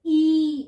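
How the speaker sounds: ई as one loud, held ee; long double-e. Shown as a demonstration. Teacher showing the double-e sound, a loud long ई. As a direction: hit ई loudly and hold it. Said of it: "इसमें double ई का sound आएगा".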